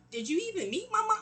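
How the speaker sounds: high incredulous challenge. Retold or imitated. Caller warns her, she snaps back incredulous. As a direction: demand it, voice jumping up in disbelief.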